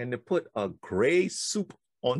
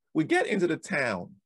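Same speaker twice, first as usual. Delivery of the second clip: ordinary talk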